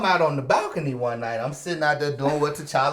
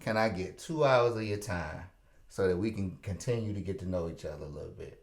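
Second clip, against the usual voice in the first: low, soft seductive voice; sexy voice; 7 semitones lower, 10 dB quieter than usual. Retold or imitated. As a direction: drop low and soft, charm her. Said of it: "In that sexy voice I just did it in".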